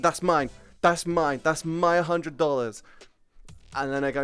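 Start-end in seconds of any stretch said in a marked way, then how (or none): 0.0-2.8 slow, louder mock demanding claim; entitled Karen. Mocking a Karen claiming the prank bill, slow and insistent. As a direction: ham up a grabby Karen. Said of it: "this Karen walks up to her and is like".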